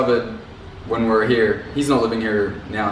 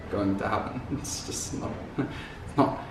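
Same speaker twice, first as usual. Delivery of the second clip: normal talking voice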